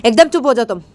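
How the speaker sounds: loud, forceful silencing command; angry order. Acted. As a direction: shut her up angrily. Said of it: "Raima को गुस्से में बोलती". (गुस्से में ज़ोर से चुप कराना)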